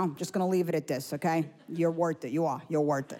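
imitating the husband sweetly insisting. Husband defends cheap gift.

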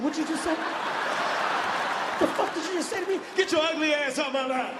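high, incredulous comic fury; mad outburst. Retold or imitated. Driver insulted by attendant, high indignant outburst. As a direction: fire back in a high, outraged voice. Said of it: "This is when I got mad".

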